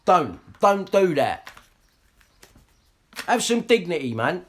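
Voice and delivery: loud, high-pitched furious ranting; angry rant. Real, not acted. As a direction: shout them down, fuming. Ranting at people on the stream.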